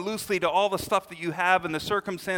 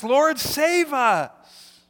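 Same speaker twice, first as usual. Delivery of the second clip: high, loud, very slow cry for rescue; desperate plea; 9 semitones higher, 8 dB louder, much slower than usual. Acted. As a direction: stretch each word in a desperate plea. Disciples cry out to Jesus.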